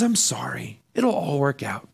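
low, soft pastoral sympathy; hushed, kindly reassurance. Acted. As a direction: console them quietly, like a gentle pastor. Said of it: "It's the kind voice".

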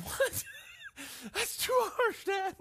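high whiny shout of outrage; protesting teen shout. Retold or imitated. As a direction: yelp it like a wronged teenager.

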